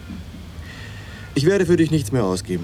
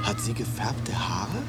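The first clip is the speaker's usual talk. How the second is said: heimliches Flüstern; 8 dB quieter, slower than usual. Acted. Olympico fragt heimlich.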